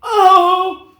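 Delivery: high, loud frightened yelp. Acted. The lion yelping as Toto barks, high and very loud. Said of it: "yelped the lion".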